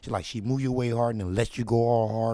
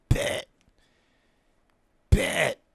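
eager shouted "bet"; excited yell; 6 semitones higher, 8 dB louder than usual. Retold or imitated. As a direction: bark it out, hyped.

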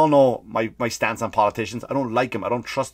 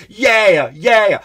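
louder and higher, fed up; loud exasperated yeah; 8 semitones higher, 8 dB louder than usual. Real, not acted. Comic venting about grandpa being pushed to dance, loud exasperated yeah. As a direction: burst out in protest.

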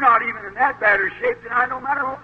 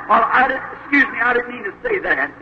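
normal talking voice

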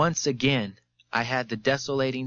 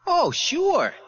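high-pitched, overconfident "sure" voice; falsely confident; 4 dB louder than usual. A narrator's reading. Witness answering his questioner, falsely confident. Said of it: "said Lepper in his new, confident, false voice".

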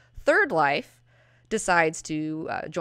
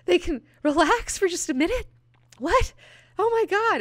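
high, loud gasping disbelief; stunned disbelief; 12 semitones higher, 4 dB louder than usual. Retold or imitated. Fan telling viewers her own shocked squeal. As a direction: squeal it out like you can't believe it. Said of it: "I was just like".

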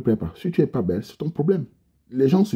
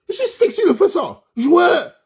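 mock brag; 6 dB louder, slower than usual. Bragging he is sexy.

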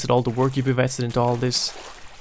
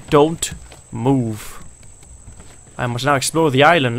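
ordinary talk

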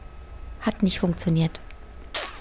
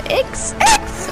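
very high frantic cry; excited squeal. Real, not acted. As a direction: squeal in a rush. Hektisches Springen im Spiel. (aufgeregtes Quieken)

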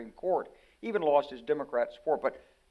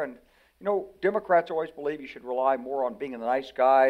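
usual speaking voice

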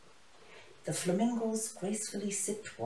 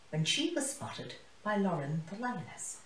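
plain reading voice

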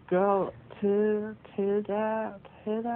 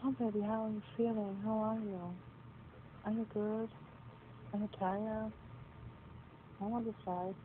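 much softer, gentle caring questions; tender and soft; 3 semitones higher, 12 dB quieter than usual. Real, not acted. Girlfriend softly checking on boyfriend after fight, tender. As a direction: ask gently, barely above a murmur.

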